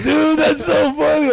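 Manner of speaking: high, drawn-out mocking cry; crowing imitation of an amused friend; imitating gleeful prankster. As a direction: stretch it out in delighted ridicule. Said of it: "he's just laughing".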